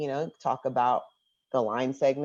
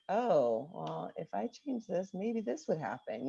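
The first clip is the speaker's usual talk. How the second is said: soft thinking-aloud voice; imitated student musing; 7 dB quieter than usual. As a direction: quietly puzzle it out, as a student would. Voicing students' thoughts while discovering.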